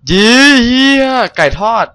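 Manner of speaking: high, loud surprised yelp; startled shout. Real, not acted. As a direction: cry out in shock. Sudden find in the game. (ร้องตกใจ)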